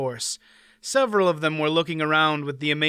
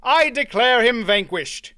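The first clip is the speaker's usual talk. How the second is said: louder, higher ringing proclamation; formal declaration; 8 semitones higher, 6 dB louder than usual. A narrator's reading. Knight declares the baron vanquished, ringing. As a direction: pronounce the verdict to all. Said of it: "Mander Allen announced".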